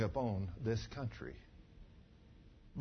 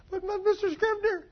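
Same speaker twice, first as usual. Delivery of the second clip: high whining protest; pleading young man. Retold or imitated. Young man caught out pleading with the preacher, high whine.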